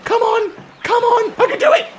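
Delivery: high, strained urging against the clock; desperate high-pitched hurry-up; frantic cheering. Real, not acted. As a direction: push yourself, time's running out. Racing the clock in game.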